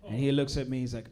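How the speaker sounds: normal talking voice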